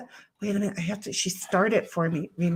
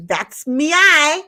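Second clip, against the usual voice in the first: hammed-up quote; 4 semitones higher, 8 dB louder, slower than usual. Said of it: "Great Liquor's like".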